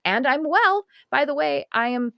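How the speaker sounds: ordinary talk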